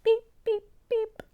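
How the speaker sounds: three high short beeps; goodbye beeps. A narrator's reading. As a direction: toot a little horn in farewell. Truck tooting goodbye.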